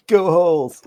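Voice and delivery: loud, high, drawn-out mock cheer; mock team cheer. Joining a pretend paper war between doodles, mock cheering. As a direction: stretch it out like a fan cheering.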